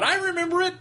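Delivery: high-pitched mock of a friend chiming in; imitating absent friend. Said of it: "Keith would have sat here and gone".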